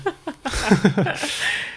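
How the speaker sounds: high, rippling giggle; laugh. Real, not acted. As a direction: giggle at your own dark joke. Laughs after bombs joke.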